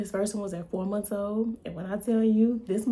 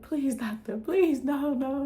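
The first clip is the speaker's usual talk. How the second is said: higher, louder imitation of a scared child begging; mock scared pleading; 6 semitones higher, 4 dB louder, slower than usual. Son begging the doctor, in a mock frightened voice. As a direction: mimic the child's frightened plea. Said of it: "my child was so upset".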